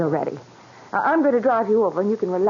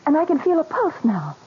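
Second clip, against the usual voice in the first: excited discovery; 8 semitones higher than usual. Acted. Patient reviving, nurse amazed.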